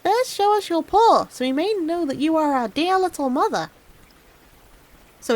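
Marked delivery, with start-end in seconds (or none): 0.0-3.7 put-on high kids' voices; wary little kids testing the wolf; much higher kids' demand; kids calling out. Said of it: "The little kids cried out".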